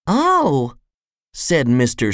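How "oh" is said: small, high voice; high little storybook voice; little character voice. A narrator's reading. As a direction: pitch it up like someone tiny. Tiny character responds.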